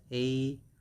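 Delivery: ATRIUM taken apart, a long AY first; broken-down pronunciation. Shown as a demonstration. Teacher pronouncing this one for learners, slow sounded-out syllables. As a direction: sound out ATRIUM piece by piece, AY, then tree. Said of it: "We pronounce this one as".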